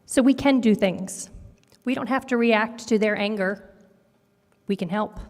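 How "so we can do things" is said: ordinary delivery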